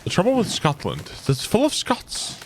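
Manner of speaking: a hint of a haughty English king scorning the Scots; Longshanks impression. Streamer quotes the villain king with a mild sneer. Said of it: "You do a pretty good long shanks".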